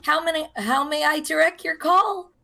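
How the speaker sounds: a hint of a receptionist; receptionist phone voice. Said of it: "answering the calls being like".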